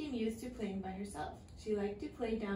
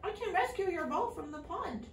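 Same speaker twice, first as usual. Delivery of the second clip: higher, helpful frog; 8 semitones higher than usual. A narrator's reading. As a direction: offer help brightly. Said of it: "answered the frog".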